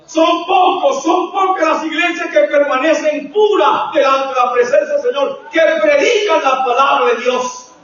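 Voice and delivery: high, shouted preaching warning; slow, hammered phrases at full force. Real, not acted. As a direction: thunder it from the pulpit.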